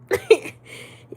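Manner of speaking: short, very high burst of laughter; real laugh. Real, not acted. Streamer laughing at chat making stuff up, high burst. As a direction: let out a high, loud laugh.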